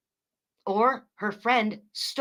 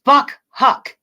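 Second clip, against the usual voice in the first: much louder, higher angry curse; repeating the curse for chat in case the audio failed, louder; 4 semitones higher, 11 dB louder than usual. Real, not acted. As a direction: say it again, loud enough to be heard. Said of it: "in case my sound was screwed up".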